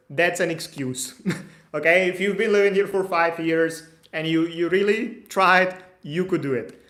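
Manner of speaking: ordinary talk, nothing marked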